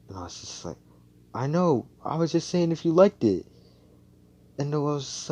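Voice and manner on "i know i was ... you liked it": high-pitched, louder self-defence; acted defensive protest. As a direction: protest your innocence, voice pitched up.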